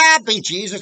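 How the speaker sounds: high, loud Irish exclamation; mock Irish brogue, pitched right up; mother's Irish brogue. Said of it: "Did that woman talk with an Irish brogue".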